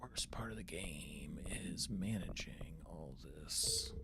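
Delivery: slow, soft whispered complaint; whispered grumble. Real, not acted. Streamer griping to himself about menu management, whispered. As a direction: grumble it under your breath, slowly. Grumbling reading game menus.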